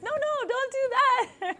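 high, loud cry of mock alarm; alarmed protest. Reader pleading with Sarah in the story, high alarmed cry. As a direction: yelp it like watching a disaster unfold. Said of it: "He's like".

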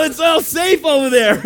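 high, loud insistence; amused shouted protest. Retold or imitated. Pastor assuring his alarmed guard, high amused shout. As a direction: shout it back through amusement.

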